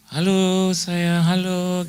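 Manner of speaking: high, slow, sugary "Halo sayang, halo" drawn out; sweet phone greeting. Retold or imitated. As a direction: coo it like greeting a dear friend.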